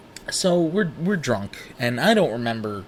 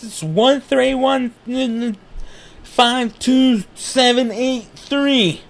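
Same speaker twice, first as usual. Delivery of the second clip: put-on drunk answer; 11 semitones higher, 5 dB louder, much slower than usual. Drunk answer to name question.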